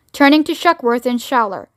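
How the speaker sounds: plain reading voice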